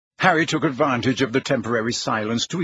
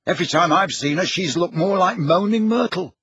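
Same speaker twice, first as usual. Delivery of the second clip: Ron, complaining; 7 semitones higher, 3 dB louder than usual. Acted.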